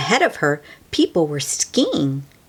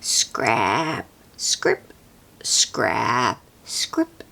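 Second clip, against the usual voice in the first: whispered scrape noises; whispered shovel sound; 6 semitones lower, much slower than usual. A narrator's reading.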